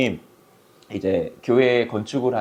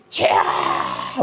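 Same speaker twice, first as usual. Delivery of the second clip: drawn-out harsh prayer scream; 12 semitones lower, much slower than usual. Retold or imitated. Pastor imitating the woman's screamed night prayer, screaming. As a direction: imitate someone shrieking out in prayer. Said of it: "막 소리를 지르는데요. / 악을 쓰는 거예요".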